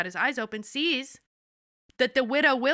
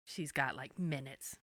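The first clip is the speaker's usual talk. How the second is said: softer, lower, grave and hushed; quiet grim verdict; 7 semitones lower, 7 dB quieter than usual. Retold or imitated. Claire telling the family the widow has minutes, hushed. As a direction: break it gently, barely above a murmur. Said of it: "examines her and is like".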